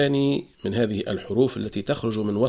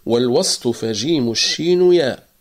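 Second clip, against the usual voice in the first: recited verse; 4 dB louder than usual. Shown as a demonstration. Teacher recites Ibn al-Jazari's verse, chanted. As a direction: chant والوسط فجيم الشين ياء in recitation style. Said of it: "قول ابن الجزري".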